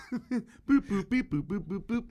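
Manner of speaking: high beeping robot noises. Pretend. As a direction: beep and boop like a machine. Imitating the dish's beeps.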